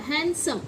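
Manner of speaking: „हैंडसम” as HAN-sum, no d; silent d. Shown as a demonstration. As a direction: repeat हैंडसम with n running into s. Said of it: "ड नहीं होता".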